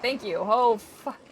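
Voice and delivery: ordinary talk, nothing marked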